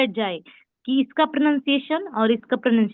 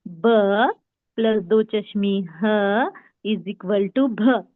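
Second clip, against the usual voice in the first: very slow, each sound drawn out; slow sound demo; 2 semitones lower, much slower than usual. Shown as a demonstration. Teacher showing students the sound भ, slow and deliberate. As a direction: spell the equation out slowly so students hear भ. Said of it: "देखिए sound, भ".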